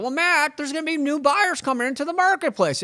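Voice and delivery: high, mocking voice of a doubter; mocking skeptic. Mocking an imagined listener who doubts Matt, in a high voice. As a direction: squeak out the objection like a know-it-all. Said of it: "somebody's gonna be like".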